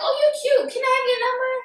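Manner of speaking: lightly high, flirty ask. Retold or imitated. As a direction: chat her up sweetly. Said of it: "I was like".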